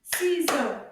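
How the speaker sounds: CI-SEAUX, both beats punched loud; loud two-beat chant of Ciseaux; clapped syllables. Shown as a demonstration. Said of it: "Can we clap the syllables".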